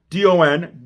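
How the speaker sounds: "D O N" spelled letter by letter. Shown as a demonstration.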